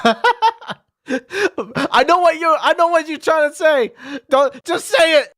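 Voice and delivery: incredulous reply to a sly teacher; high, loud laughing retort. Acted.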